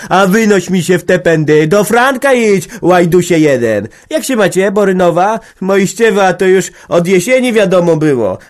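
głośne, gniewne połajanki wyżej; wrzask. A narrator's reading. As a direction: krzycz i łaj bez przerwy. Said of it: "wrzasnęła raz jeszcze". (organiścina wrzeszczy i wygania Magdę)

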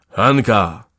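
loud, firm call of a name; sudden strong summons; clear and forceful. A narrator's reading. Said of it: "powiedział wyraźnie i mocno".

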